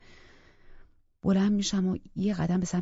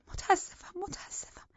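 whispered apology fading to almost nothing; whispering; 12 semitones higher, 5 dB quieter, slower than usual. Acted. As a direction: breathe out sorry twice.

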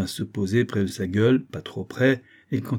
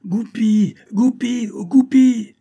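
high, loud hunting call; ringing high-pitched shouts; crying out; 13 semitones higher, 8 dB louder, slower than usual. A narrator's reading. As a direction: call out sharply across the woods. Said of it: "on entendit crier".

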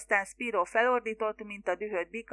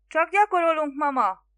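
much higher, louder shouted reply; shouting back; 7 semitones higher, 8 dB louder than usual. A narrator's reading. Son shouts back to mother from afar, higher and louder. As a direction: yell back across the bay. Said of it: "kiabált vissza Leslie".

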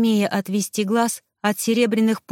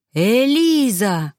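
long, drawn-out happy call; joyful cry; 2 semitones higher, 4 dB louder, much slower than usual. A narrator's reading. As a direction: call out the name in delight. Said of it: "Радостный вопль раздался откуда-то сверху".

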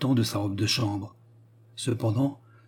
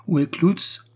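high, rough-mannered question; brusque demand; 7 semitones higher, 4 dB louder, slower than usual. A narrator's reading. Steward demanding the vicar from the pastor's household, brusquely. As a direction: demand it rudely, without preamble. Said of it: "demanda rudement Delvig".

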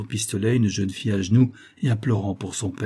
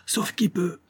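panicked exclamation; 8 semitones higher, slower than usual. A narrator's reading. Marauders give up without resistance, crying out in alarm. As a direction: call for everyone to flee. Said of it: "s'écrièrent les maraudeurs sans même tenter de résistance".

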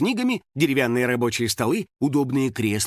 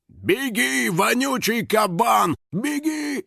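high, drawn-out yell; 8 semitones higher, much slower than usual. A narrator's reading. Biker urging the house spirit to flee, yelling. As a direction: holler a warning, stretching the words. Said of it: "заорал байкер".

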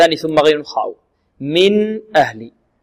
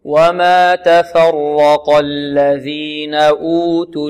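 slow, drawn-out tajweed recitation; Quran recitation; much slower than usual. Shown as a demonstration. Teacher models tajweed recitation for the class, slow and chanted. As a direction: chant it slowly as a model for students.

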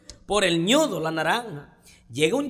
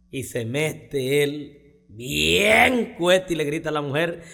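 normal talking voice

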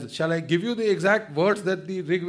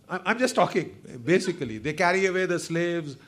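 ordinary talk, nothing marked

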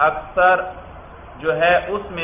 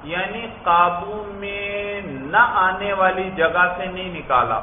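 slow drawn-out explaining; 3 semitones higher than usual. Shown as a demonstration. Teacher explains the phrase slowly.

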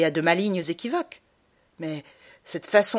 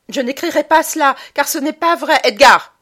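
loud, much higher menacing cry; threatening shout; 8 semitones higher, 12 dB louder than usual. Acted. Mother threatening her son, shouting. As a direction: threaten him, full voice. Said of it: "cria-t-elle sur un ton de menace".

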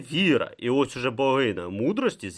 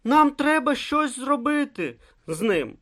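worried insistence; 3 dB louder than usual. A narrator's reading. Brutha pleads over the injured man.

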